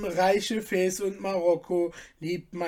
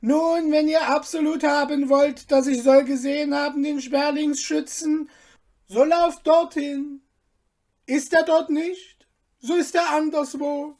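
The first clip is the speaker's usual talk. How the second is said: higher, quick, nervous; flustered Abner; 8 semitones higher, 7 dB louder than usual. Acted. Abner hastily sending the soldiers away, nervous. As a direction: babble the answer in a hurry.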